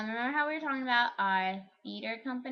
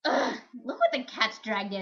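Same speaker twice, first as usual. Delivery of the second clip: witch voice; 2 semitones higher, 7 dB louder than usual. Retold or imitated. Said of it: "I would just come on and be like".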